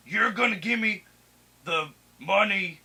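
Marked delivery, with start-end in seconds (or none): none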